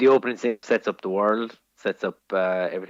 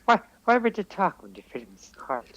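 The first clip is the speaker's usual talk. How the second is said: put-on light Irish old-lady voice, higher; imitating chatty old woman; 7 semitones higher than usual. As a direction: mimic the gasping woman behind you in the cinema. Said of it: "Two fifty-something-year-old women".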